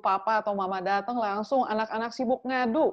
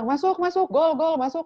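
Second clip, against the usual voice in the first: higher-pitched mock football cheer; imitating an excited football fan; 7 semitones higher, 4 dB louder than usual. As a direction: cheer the ball into the goal.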